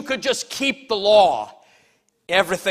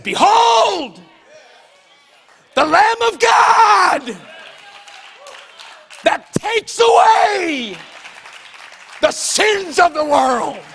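"Behold" shouted much higher and louder, slow and ringing; inflected proclamation; 12 semitones higher, 8 dB louder, slower than usual. Shown as a demonstration. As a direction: proclaim "the Lamb of God" like a prophet crying out. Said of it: "you have to inflect your voice".

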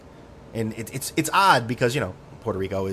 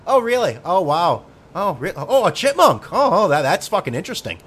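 high, loud sarcastic gushing; hammed-up sarcastic wonder; mock amazement; 8 semitones higher, 7 dB louder than usual. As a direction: fake wide-eyed awe at a dull story. Mock awe at chipmunk story.

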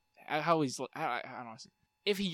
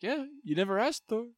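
higher, lightly put-on casual Korg; mock Korg voice; 9 semitones higher than usual. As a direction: shrug it off as Korg would. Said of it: "It's like".

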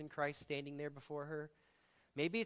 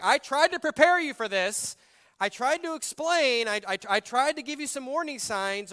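loud, high, stammering I-told-you-so; high exasperated rant; mock scolding Jesus; 10 semitones higher, 14 dB louder than usual. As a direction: scold her like an exasperated teacher. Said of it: "He doesn't say, like".

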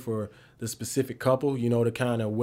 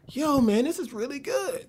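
impressed coach; 13 semitones higher, slower than usual. Retold or imitated. Coach voiced in the story.

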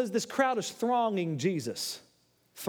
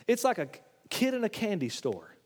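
ordinary delivery

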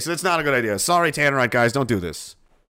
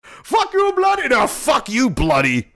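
mock angry scammer; 5 semitones higher, 4 dB louder, slower than usual. Scammer cursing before hanging up, mock angry accent. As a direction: imitate a scammer's sputtering rage.

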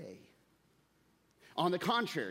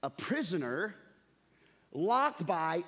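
ordinary talk

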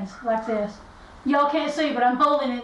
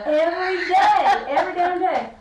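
emphatic shout; 6 semitones higher, 4 dB louder than usual. Real, not acted. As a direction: belt it out with feeling. Emphatic about fighting roosters daily.

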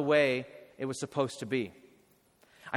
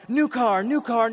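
higher, louder excited chant of "new car"; Oprah giveaway; 6 semitones higher, 6 dB louder than usual. Retold or imitated. Pastor imitating Oprah handing out cars, loud and excited. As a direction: hype it like a TV giveaway. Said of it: "like Oprah".

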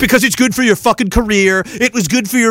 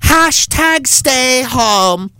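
very slow, drawn-out smug slogan; mocking politician; 5 semitones higher, much slower than usual. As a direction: drag out the slogan like a smug celebrity. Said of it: "and you said".

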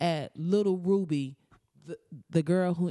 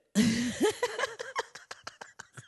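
high cackle. Real, not acted. Laughing at own segue.

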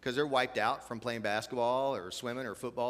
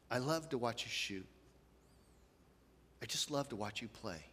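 gentle loving words; 6 dB quieter than usual. Retold or imitated. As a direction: quietly tell your child you love them. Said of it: "this wonderful phrase".

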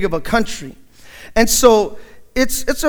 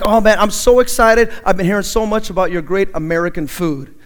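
ordinary talk

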